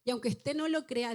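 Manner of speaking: usual speaking voice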